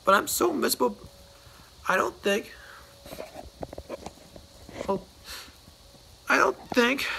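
a moping whine that keeps stalling; miserably. Acted. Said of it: "he added miserably".

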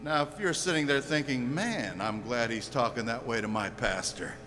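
very low, softened preaching; grave hushed rebuke. Real, not acted. Preacher turning on listeners who blame their pastor, grave and hushed. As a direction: drop right down and warn them quietly.

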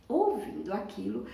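usual speaking voice

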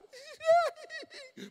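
shrieking crowd-pleasing laugh; loud high laugh. Real, not acted. Laughs at the scene.

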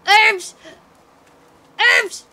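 high, loud one-word bursts; excited shout. Pretend. Player calling out herbs in the game, excited shout. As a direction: shout it high, like a treasure spotted.

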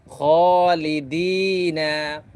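each word drawn out slowly with long madd; elongated vowels. Shown as a demonstration. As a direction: read each word stretching the vowel one alif. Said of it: "মদগুলা কীভাবে এক আলিফ লম্বা করে পড়তেছি". (মদ টেনে ধীরে পড়া)

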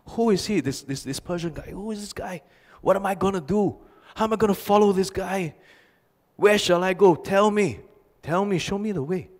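high, urgent, pleading questions; a retold migrant's frantic plea, pitched high; agitated pleading. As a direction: beg for answers, worked up and pitched high. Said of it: "He's really agitated".

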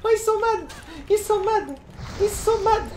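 high, squealing glee; gleeful gloating. Real, not acted. Streamer gloats at the furious enemy, squealing high. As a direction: crow with delight at his rage.

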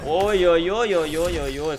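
excited oh-oh-oh. Real, not acted. Commentator reacts to a combo.

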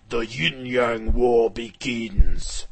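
loud, slow title card boom; dramatic title announcement. A narrator's reading. Narrator announcing the war's beginning, dramatic. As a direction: announce the war's start, drawn out and low.

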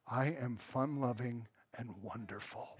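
very quiet, low mock protest; understated, ominously calm claim of niceness; deadpan self-praise. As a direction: drop way down low and soft, ironically sweet. Mock protest at staff calling him moody.

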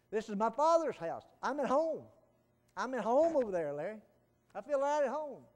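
loud, high fervent declaration; preaching at full force. Acted. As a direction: proclaim it's your Father's house, loud.

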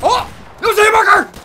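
very high, loud cry of disbelief; excited shriek. Real, not acted. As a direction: yelp in real excitement. Gamer shocked by a hit.

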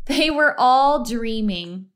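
ordinary delivery, nothing marked

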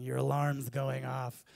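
loud, slow, grumpy wake-up yell; imitating a wife yelling the alarm is going off; annoyed wife shout. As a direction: bark it at a sleeping husband. Wife beating him with pillow.

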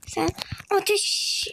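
ordinary talk

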